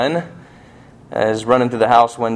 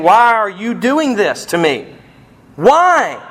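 high, anguished child's protest, acted; angry crying child; 8 semitones higher, 3 dB louder, slower than usual. Two-year-old to his father holding him down, acted anguished plea. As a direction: imitate a hurt child demanding answers, rising loud. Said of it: "he's getting angry, and he's crying".

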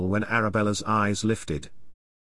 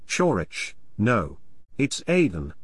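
usual speaking voice

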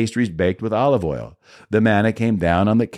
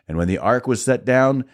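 plain reading voice

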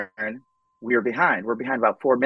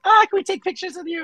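much higher, fast, gushing fan voice; faster than usual. Retold or imitated. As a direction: fans in line begging for pictures, high and quick. Fans in line imitated.

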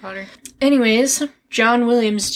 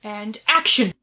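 film set calls; 5 semitones higher than usual. Pretend. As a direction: call it like a director on set.

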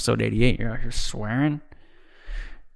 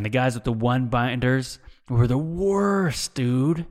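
normal talking voice

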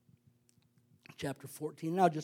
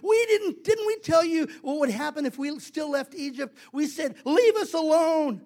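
loud, higher-pitched complaint; acted indignant scolding, question after question; hammed-up protest of a fed-up crowd; telling God off; 5 semitones higher, 4 dB louder than usual. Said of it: "they're really telling God off".

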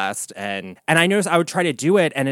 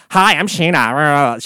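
Sheena impression; 7 dB louder, slower than usual. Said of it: "I do an impression of on stage".

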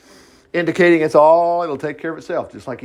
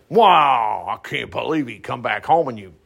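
prodigal's brother; 6 semitones lower than usual. Acted. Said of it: "We can't be like the prodigal's brother".

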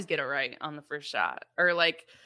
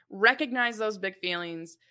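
usual speaking voice

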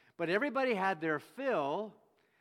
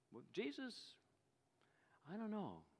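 doubtful listener; 4 semitones higher, 11 dB quieter than usual. Retold or imitated.

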